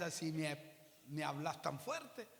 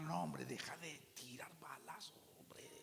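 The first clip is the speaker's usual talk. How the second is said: hushed retold plea; 10 dB quieter than usual. Calming armed cousins down.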